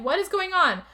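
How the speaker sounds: high, insistent questions; mock frantic bewilderment. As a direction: imitate her demanding answers in rising panic. Retold woman baffled by their laughing.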